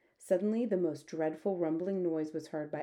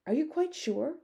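high, worried question; anxious, high-voiced doubt; 9 semitones higher than usual. A narrator's reading. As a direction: ask it nervously, pitched well up. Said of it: "the army man said anxiously".

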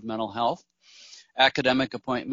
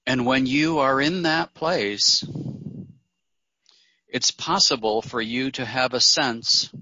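guided meditation. Acted. Meditation leader inviting listeners to breathe and journey within, calm guided meditation. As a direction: guide them gently inward, letting phrase endings sink.

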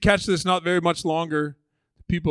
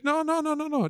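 gentle high no; 11 semitones higher than usual. Retold or imitated. Jesus gently refusing.

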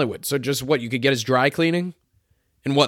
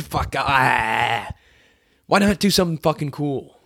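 ordinary talk, nothing marked

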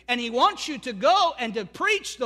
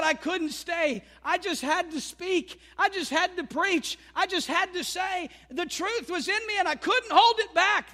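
voicing Jeremiah; 7 semitones higher than usual. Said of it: "He said".

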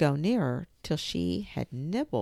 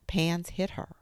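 usual speaking voice

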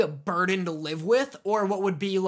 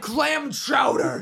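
raised, slow orc shout; newborn orc; 4 semitones higher, much slower than usual. Acted. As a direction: bellow the order like an orc, drawn out. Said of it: "an orc guy comes out of the womb".

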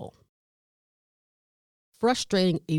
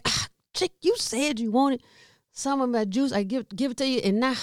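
higher, exasperated complaint; exasperated at mom; 6 semitones higher than usual. Retold or imitated. Caregiver exasperated at mom who spilled the juice, higher whine. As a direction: whine it like you gave her your juice for nothing. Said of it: "So now I'm frustrated because I'm like".

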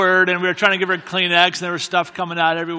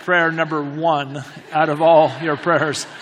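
usual speaking voice

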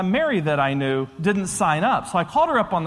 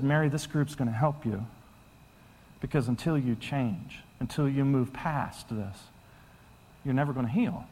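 low, soft, earnest urging; gentle counsel; 7 semitones lower, 8 dB quieter than usual. Retold or imitated. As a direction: urge her tenderly in a hushed low voice. Retelling his gentle counsel to Mary.

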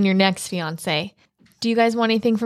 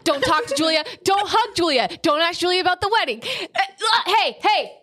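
higher, bossy crowd-control voice; mock drill sergeant; 9 semitones higher than usual. Friend plays her marshalling the barn about Julia. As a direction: order the whole barn around like a camp counselor.

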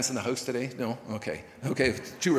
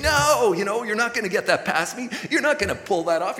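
higher, louder firm refusal to temptation; defiant no; 6 semitones higher, 4 dB louder than usual. Acted. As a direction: slam the door on the world, half laughing. Voicing believer refusing the world.

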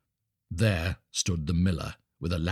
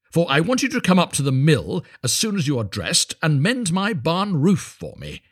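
higher, hearty ordering about; bossy miller; 7 semitones higher, 4 dB louder than usual. A narrator's reading. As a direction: hand out the chore breezily.

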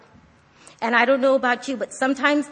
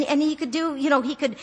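ordinary talk, nothing marked

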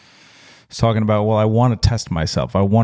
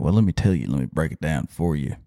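ordinary talk, nothing marked

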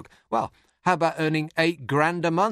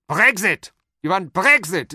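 loud, higher barked German Brexit; German shouting; 5 semitones higher, 8 dB louder, slower than usual. Retold or imitated. Comedian imitating German leaders, loud German accent. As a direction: bark Brexit like a German hotelier. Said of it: "But the Germans are the best".

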